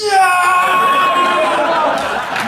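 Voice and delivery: high, long cheer as the wave hits; cheering yeah. Retold or imitated. Fans in the upper deck cheering as the wave reaches them, high and drawn out. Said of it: "we're just like".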